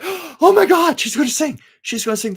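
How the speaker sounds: retold excited squeal, high and loud; high-pitched reenacted fan excitement. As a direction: gush it like a thrilled fan reliving the moment. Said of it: "And I was like".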